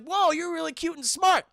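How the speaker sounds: acted boyish amazement; amazed admiration. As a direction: coo over a clever pet. Billy amazed by Gizmo.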